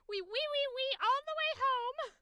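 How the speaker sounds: high sing-song Wee, wee, wee; little piggy squeal. Pretend. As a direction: squeal it like the nursery-rhyme pig. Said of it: "like a pig".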